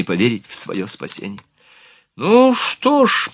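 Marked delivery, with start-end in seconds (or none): 2.2-3.3 slow, high-pitched sighing voice. A narrator's reading. Madame Bursay after their escape, sighing in relief. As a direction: let it out slowly like a sigh. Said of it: "вздохнула мадам Бюрсе".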